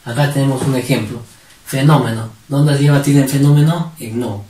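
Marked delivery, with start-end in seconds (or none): none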